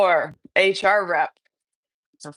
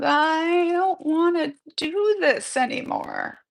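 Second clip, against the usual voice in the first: put-on higher, slow child's voice; kid's voice; 10 semitones higher, 4 dB louder than usual. The child puppet quits, in a higher, slow kid voice. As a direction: say it like a tired child. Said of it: "the kid's voice says".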